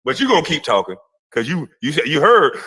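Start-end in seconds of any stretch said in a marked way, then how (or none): none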